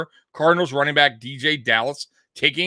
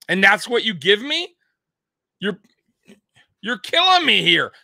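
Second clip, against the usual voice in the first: raised, louder furious coach rant; pretend chewing-out; 5 semitones higher, 3 dB louder than usual. Head coach scolding special teams after the game, angry raised rant. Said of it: "after that game and said".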